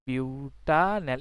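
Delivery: Butanal drawn out slowly, syllable by syllable; slow dictation. Shown as a demonstration. Teacher dictating butanal slowly to the class. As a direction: spell out butanal slowly so students can write it. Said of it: "teacher repeats the name slowly".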